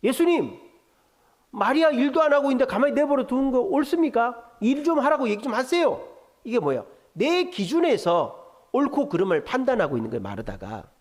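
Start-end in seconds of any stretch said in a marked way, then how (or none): 0.0-6.1 high, indignant scolding; Martha. Shown as a demonstration. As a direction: demand it as an accusing complaint. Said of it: "예수님을 야단치는 거죠".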